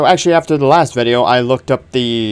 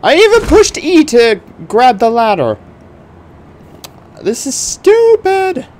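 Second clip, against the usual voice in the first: frustrated; 12 semitones higher, 4 dB louder than usual. Real, not acted. Stuck, ladder grab failing.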